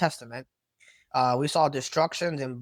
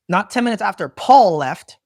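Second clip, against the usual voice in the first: sarcastic; 3 semitones higher, 7 dB louder than usual. Real, not acted. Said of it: "speaking as a sarcas-, sarcastically".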